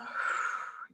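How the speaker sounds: long, soft breath out; exhale through mouth. Shown as a demonstration. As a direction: demonstrate a deep, slow exhale through the mouth. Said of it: "out through the mouth".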